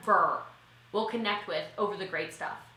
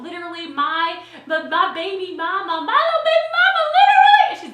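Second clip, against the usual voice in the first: gushing excitement; 7 semitones higher, 11 dB louder than usual. Real, not acted. Gushing about her best friend.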